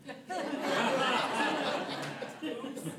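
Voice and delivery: high burst of self-amused laughter; laughing. Real, not acted. Laughing at herself over friend's "do not get emotional" advice, laughing. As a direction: break into a high, sustained laugh.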